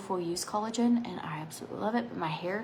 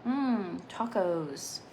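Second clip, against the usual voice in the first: usual speaking voice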